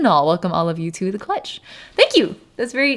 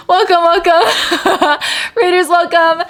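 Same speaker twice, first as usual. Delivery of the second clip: high, loud greeting broken by laughter; laughing welcome; 10 semitones higher, 5 dB louder than usual. Real, not acted. Streamer welcomes raiders to the stream, excited and laughing. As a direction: welcome them loudly while laughing.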